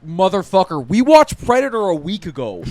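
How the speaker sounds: high, loud indignant yell; kid's outburst. Retold or imitated. Kid yelling at his dad over the game, indignant shout. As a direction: protest at the top of your voice. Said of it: "which I was like".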